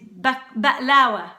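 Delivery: ba'lawa with a catch after ba, slowly; Levantine pronunciation. Shown as a demonstration. Cook introducing the confection, Levantine pronunciation. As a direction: say ba'lawa as ba'-LAA-wa. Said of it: "we say ba'lawa".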